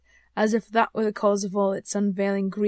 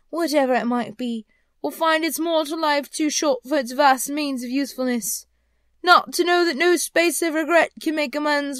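raised voice, full of regret; lamenting ghost; 5 semitones higher, 7 dB louder than usual. Acted. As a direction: declaim like a phantom mourning a wasted life. Ghost laments its wasted life.

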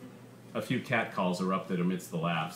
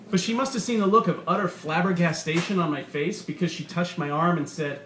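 brash comic doing his bit, higher and punchier; stand-up comedian; 6 semitones higher than usual. A narrator's reading. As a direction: play the comic riffing to a laughing crowd. Comedian's routine continues.

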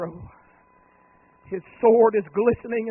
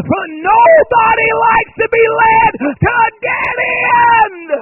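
loud, much higher shouted climax; full-force preaching; 15 dB louder, slower than usual. Real, not acted. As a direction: hammer the point home at the top of the voice. Preaching peak.

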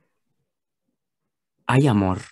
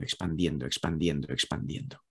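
fading echo; 7 semitones lower, 8 dB quieter than usual. Shown as a demonstration. Love expanding, echoed words fading.

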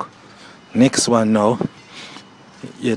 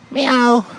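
very high, loud cat meow; a shrill playful cat cry; 13 semitones higher, 3 dB louder than usual. Pretend. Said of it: "me lick it again and".

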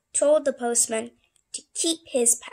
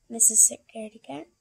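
plain reading voice

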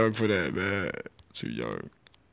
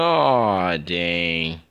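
ordinary delivery

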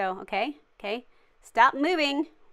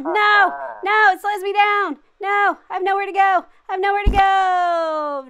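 high loud panicked cry; yell, trapped; panicked shout; 8 semitones higher, 8 dB louder than usual. Real, not acted. Stuck, chef approaching.

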